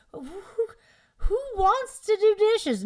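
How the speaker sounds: high, loud exasperated question; mock exasperated husband. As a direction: fling the question up high in disbelief. Acted couple quarrel over dishes.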